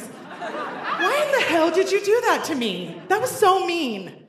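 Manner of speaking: high-pitched, aggrieved mock outburst; tipsy indignant complaint. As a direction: whine the accusation after a few drinks. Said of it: "after a few drinks".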